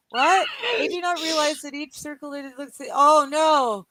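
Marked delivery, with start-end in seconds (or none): 2.9-3.9 rising, louder and louder in dismay; a scandalised cry building up; shocked oh no. Real, not acted. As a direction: gasp out oh no at Dom's joke. Shocked by a rude joke.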